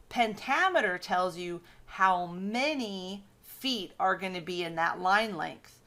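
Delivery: ordinary delivery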